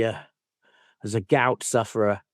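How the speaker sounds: ordinary talk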